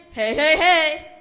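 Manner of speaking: booming jolly hey hey hey; mock Santa laugh. Bear booming Santa's greeting, jolly and loud. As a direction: belt it out like Santa. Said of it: "what does he say again".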